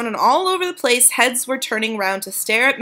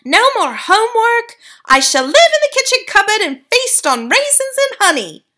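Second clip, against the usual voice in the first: put-on grinning mouse-boy; 13 semitones higher, 7 dB louder than usual. A narrator's reading. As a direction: savor it with a grin. Bruno continues gleefully.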